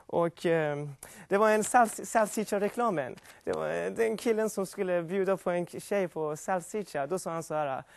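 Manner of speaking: usual speaking voice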